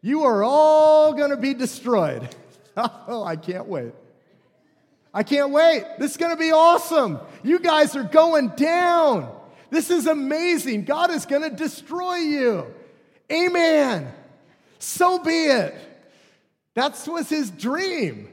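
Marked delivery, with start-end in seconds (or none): 0.0-2.3 loud, slow, gloating proclamation; Jonah's gleeful doom shout. Retold or imitated. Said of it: "he's like". 2.8-15.8 high, loud, gleeful shouting; delighted prophet of doom; gloating Jonah. Retold or imitated. Jonah gloating over destruction.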